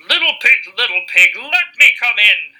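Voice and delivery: loud, high wolf voice; growling wolf. Acted. Wolf at the straw house door, loud growling call. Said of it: "he growled".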